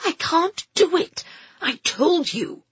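high, panicked woman's voice; distraught refusal. A narrator's reading.

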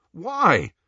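loud, high startled question; shocked. A narrator's reading. Ron questions Harry's choice of him, shocked. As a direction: demand a reason, taken aback. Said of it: "looking shocked".

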